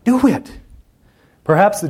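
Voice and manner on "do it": short high loud push; emphatic command. Acted. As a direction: punch it out as a rallying order. Preacher repeats command emphatically.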